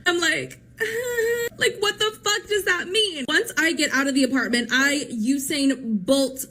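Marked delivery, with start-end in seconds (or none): 1.6-3.2 high shocked laugh breaking into disbelief; nervous laugh. Real, not acted. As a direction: laugh in alarm, pitch up. Terrified after creepy remark.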